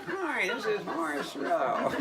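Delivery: high, slow squeaky voice. Retold or imitated. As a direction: squeak it out like a thin-voiced preacher. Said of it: "He's got kind of a squeaky voice".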